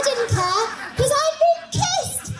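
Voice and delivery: high, slow, swooning delight; giddy triumph. Acted. Suze recalling her first kiss, high and giddy. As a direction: savour the memory of the kiss.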